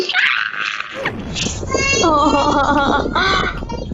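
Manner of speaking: drawn-out, breathless laughing fit; long giddy laugh. Real, not acted. Gamer after a close call, a long giddy laugh. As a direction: let the panic spill into laughter.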